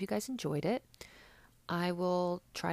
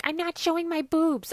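shrieked high and loud, mocking Vicky's protest; Vicky on the street refusing beads, loud high squeal; 14 semitones higher, 12 dB louder than usual. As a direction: squeal it like a tipsy girl waving off beads. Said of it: "Vicky being like".

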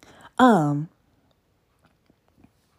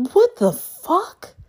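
outraged disbelief; 11 semitones higher, 3 dB louder, much slower than usual. Real, not acted. Outraged at dating app match.

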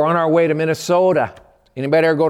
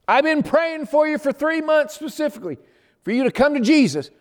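amazed joy; 11 semitones higher than usual. Retold or imitated. Brother answering sister's news.

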